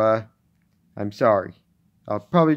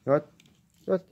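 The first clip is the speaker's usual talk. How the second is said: usual speaking voice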